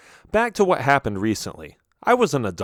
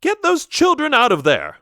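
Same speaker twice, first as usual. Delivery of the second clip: high, loud commanding shout; angry parent shout; 5 dB louder than usual. Retold or imitated. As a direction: bark it like a frantic parent. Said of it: "one of the parents shouted".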